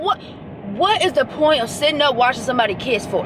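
normal talking voice

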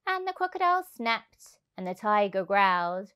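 ordinary delivery

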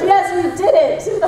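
high, drawn-out cheer through laughter; mock triumph. Voice actors cheering a matched flap, mock-triumphant. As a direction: celebrate it slowly, up high, half laughing. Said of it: "We're like".

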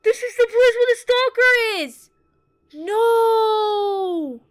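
loud, high, panicked shouting; horrified wail. Real, not acted. Player seeing the stalker's food, horrified high wailing. Horror at stalker's living space.

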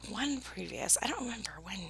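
whispering. Real, not acted. Aside while opening mail.

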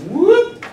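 high, loud swooping whoop; rising whoop. Shown as a demonstration. Teacher traces a cubic curve for the class, rising whoop. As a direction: swoop sharply up in pitch on a whoop. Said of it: "y equals x cubed does this".

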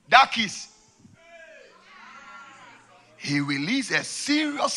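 high, loud fiery warning; preaching at full force. Acted. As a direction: preach it with urgency.

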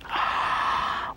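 soft unvoiced gasp; gasp of realisation. Child realising Brer Rabbit is real, soft gasp. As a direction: catch your breath in wonder.

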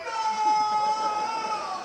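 loud high scream, dragged out. Retold or imitated. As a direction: scream it in horror from the sideline. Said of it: "I'm screaming".

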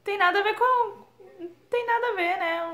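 high, loud insistent denial; emphatic denial. Real, not acted. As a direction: protest that it's not rudeness. Nega com ênfase. (negação enfática, aguda e alta)

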